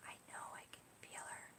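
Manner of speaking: a barely voiced whisper, quick; whispered child. Niece confiding to her aunt in the car, whispered. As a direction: breathe it like a secret.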